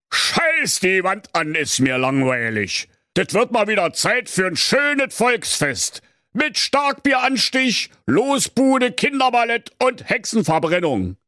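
high, bored grousing king; grumbling king. Acted. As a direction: whine from the throne. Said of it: "motzte vor sich hin". (hoher, motzender, gelangweilter König)

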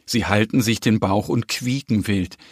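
plain reading voice

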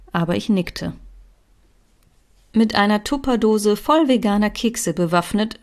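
ordinary delivery, nothing marked

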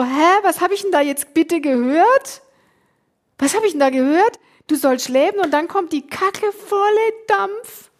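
high-pitched, incredulous protest; indignant disbelief. Retold or imitated. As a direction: protest to God, pitch climbing as it goes. Retold indignant protest to God.

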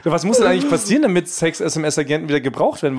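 normal talking voice

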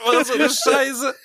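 words squeezed out through laughter; laughing hard. Real, not acted. Laughing hard while talking. (laut lachend sprechen)

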